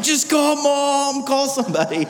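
mock agony in the car; panicked plea. Father in pain to daughter, panicked strained plea.

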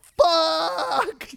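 loud, high drawn-out yell; long high-pitched mock scream; yelled curse. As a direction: re-enact a startled shout in a theater. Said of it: "stand up and yelled".

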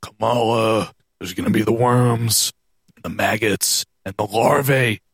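mock-menacing low voice with pauses between phrases; Undertaker impression. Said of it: "Undertaker cuts some weird-ass promo".

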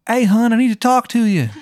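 high-pitched, hammed-up Maryland accent; cartoonish Maryland twang, high and calling; bad Maryland accent. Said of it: "this really shitty Maryland accent".

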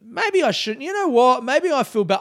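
high, hesitant second thoughts; sheepish. Real, not acted. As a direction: pull back nervously. Suddenly doubts calling them out.

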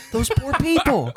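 high, slow mock pity; empathetic little kid. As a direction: imitate a child's heartfelt sympathy, drawn out high. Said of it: "such an empathetic little kid".

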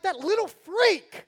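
hammed-up fury at a preschooler; mock outrage. Dad reacting to the girl refusing his daughter a hug, mock rage. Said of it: "And I'm like".

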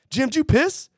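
high-pitched disbelieving question; incredulous accusation. Retold or imitated. Damp buddies confronting Jim over the beer story, incredulous. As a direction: imitate an incredulous group confronting someone. Retold group confronting the suspected friend.

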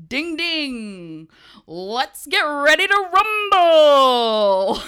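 slow, booming, stretched-out announcer call; ring announcer. Pretend. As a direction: belt it out like a boxing emcee. Mock boxing ring announcer intro.

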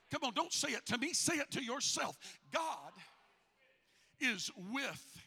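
normal talking voice

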